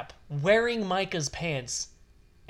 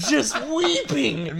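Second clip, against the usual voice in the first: laughing; 7 semitones higher, 4 dB louder, much slower than usual. Real, not acted.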